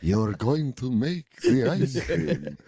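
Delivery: slow, drawn-out Transylvanian vampire hypnosis; Transylvanian vampire accent. Pretend. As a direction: stretch every word like a Transylvanian count casting a spell. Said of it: "Is that a Transylvanian accent?".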